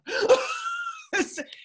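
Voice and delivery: bright burst of real laughter; high laugh. Real, not acted. Speaker laughs at her dealership story, real laugh. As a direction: laugh at your own punchline.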